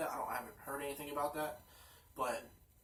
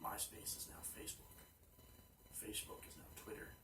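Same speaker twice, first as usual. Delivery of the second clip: soft hushed whisper; 19 dB quieter than usual. Real, not acted. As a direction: whisper it under your breath. Hushed closing banter.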